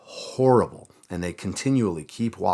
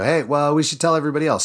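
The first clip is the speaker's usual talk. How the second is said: higher, louder, eager voice; mock excitement, pitched up; excited retold quote; 9 semitones higher, 6 dB louder, faster than usual. Men tell each other to share the find, excited.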